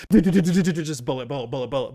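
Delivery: rattling machine-gun stutter; loud, stuttered burst of mock gunfire; imitated gunfire. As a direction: act out a spray of bullets. GM imitates gunfire hitting Snake.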